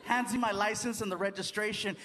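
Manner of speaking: normal talking voice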